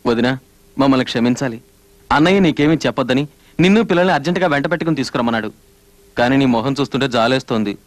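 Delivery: low, sombre and hesitant; grave, apologetic. Acted. Brother-in-law begging forgiveness before bad news, grave and low.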